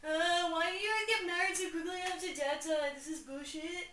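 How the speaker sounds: hammed-up moody son; whiny emo Hamlet. Hamlet sulking at his mother and uncle. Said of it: "he's like".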